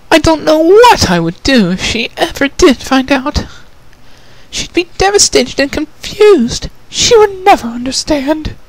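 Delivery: high, gentle refined lady, a touch sad; Rarity, sad. Acted. Rarity asks about her sister, gently sad.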